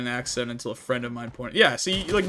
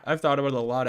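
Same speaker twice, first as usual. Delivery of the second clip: ordinary delivery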